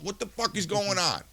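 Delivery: ordinary delivery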